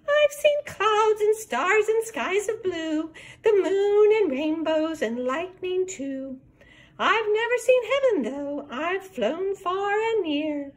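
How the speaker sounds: high twittering bird; little bird voice. Acted. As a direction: sing it out like a sweet small bird. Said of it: "sweet Missus Peep".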